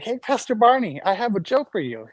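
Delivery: a hint of a high, eager child; lightly voiced little boy, keen to share; eager little kid. Little kid telling his pastor a joke, lightly high and eager. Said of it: "I was like".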